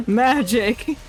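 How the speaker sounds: usual speaking voice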